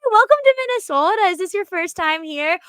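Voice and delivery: put-on high, loud, sugary Minnesota accent; Minnesota nice accent. Greeting Eagles fans at the mall, high syrupy Minnesota accent. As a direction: gush a fake-sweet Midwestern welcome. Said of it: "go hard on the accent".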